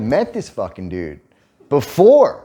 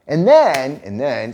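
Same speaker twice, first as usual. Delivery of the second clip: ordinary talk, nothing marked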